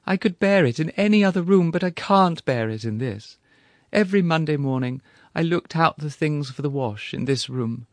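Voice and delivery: high, loud, tearful pleading; anguished widow. Acted. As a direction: wail it out like a grieving widow. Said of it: "cried Missus Badgery, wringing her hands".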